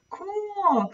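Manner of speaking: high, delighted exclamation; excited cry. Acted. Clara seeing the new butterflies, a high delighted cry. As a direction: squeal it with wonder. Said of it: "cried Clara".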